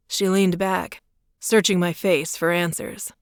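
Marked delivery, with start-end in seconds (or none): none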